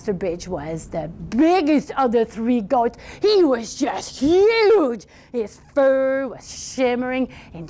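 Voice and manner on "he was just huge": "huge" stretched out long and high; stress on huge. A narrator's reading. Storyteller describing the biggest goat, "huge" greatly drawn out. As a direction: draw out "huge" slowly with a pitch swoop.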